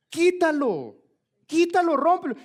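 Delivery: insistent high-pitched command; urgent exhortation. Real, not acted. Preacher commands cutting off sin.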